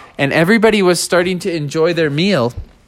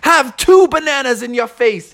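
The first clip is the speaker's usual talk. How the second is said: high, taunting character voice; playful battle cry in a high voice; Monk Master; 7 semitones higher than usual. Acted. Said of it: "And Monk Master said".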